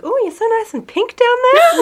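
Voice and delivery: very high, amused imitation; mock cooing. Retelling nurse at pap smear, high mock cooing. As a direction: coo it in a high voice.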